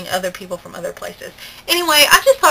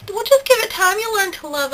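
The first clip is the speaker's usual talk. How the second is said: mom's sweet reassurance; 11 semitones higher than usual. Retold or imitated. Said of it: "Mom said".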